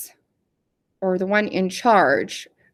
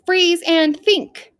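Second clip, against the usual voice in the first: slow, higher-pitched game command; foreman's command; 7 semitones higher, much slower than usual. Acted. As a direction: call out the instruction, drawn out. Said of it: "when the foreman says".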